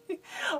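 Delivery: giggle. Real, not acted. Laughs at chihuahua nickname.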